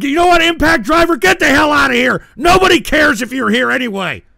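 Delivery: loud, high yelling; real furious yelling at a chatter; angry shout. Real, not acted. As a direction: shout the viewer out of the room. Kicking a viewer out of chat.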